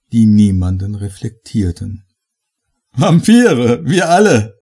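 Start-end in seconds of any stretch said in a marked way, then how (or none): 2.9-4.6 high, loud laughing cry. Acted. Fletcher toasting the guests, laughing loud. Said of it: "lachte Mister Fletcher".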